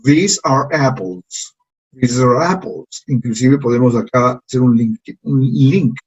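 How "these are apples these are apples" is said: "These are apples" slow with long vowels, falling; slow model sentence. Shown as a demonstration. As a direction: model it slowly for the class. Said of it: "repitan después de mí".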